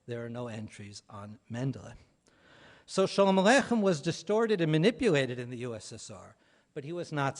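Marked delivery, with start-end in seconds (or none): none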